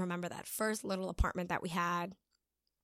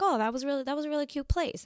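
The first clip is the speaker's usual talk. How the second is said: higher, fast, delighted gush; excited gushing; 7 semitones higher, faster than usual. Retold or imitated. To her boyfriend, gushing over the apartment, higher and fast. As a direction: rave about the place, quick and bright.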